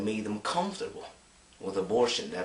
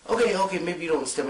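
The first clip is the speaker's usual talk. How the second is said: louder, higher protest; imitated exasperated arguer; 9 semitones higher, 7 dB louder, faster than usual. Imagined defender argues back at the objector, exasperated raised voice. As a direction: cut in loudly to set them straight. Acts arguing defender of abortion.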